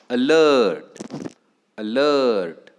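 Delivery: "Alert" with a loud, long, falling लर्ट; stress on second syllable. Shown as a demonstration. Teacher giving second example, "alert" stressed on लर्ट. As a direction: punch and stretch "लर्ट" in "alert". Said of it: "Stress is on the".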